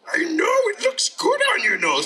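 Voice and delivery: high, pushed cartoon voice; delighted story monster; excited monster. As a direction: admire it loudly and high, like an eager creature. Monster admires its work.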